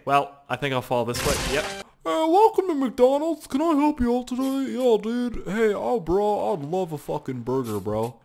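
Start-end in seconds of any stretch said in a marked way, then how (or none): 2.1-8.2 mock cashier voice. Mock McDonald's cashier and customer.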